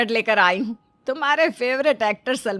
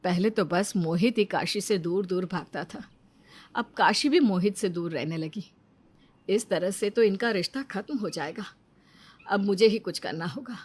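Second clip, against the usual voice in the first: worried to herself; 5 semitones lower, 9 dB quieter than usual. Acted. Mother-in-law worries to herself.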